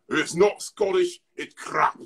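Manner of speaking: high, slow mock-Scottish outburst; Mike Myers. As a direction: exclaim it in exaggerated Scottish. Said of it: "Mike Myers when he used to do that".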